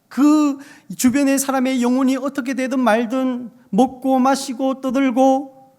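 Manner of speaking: loud, impassioned pulpit delivery; forceful preaching. Real, not acted. Preacher challenges believers who neglect their mission, forceful preaching. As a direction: drive it home loudly to the congregation.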